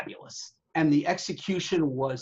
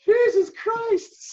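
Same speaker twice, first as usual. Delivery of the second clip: high, slow, louder exasperated groan; the boss losing patience, exasperated high drawn-out cry; 5 dB louder, much slower than usual. Retold or imitated. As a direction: throw up your hands as the fed-up boss. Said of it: "he's like".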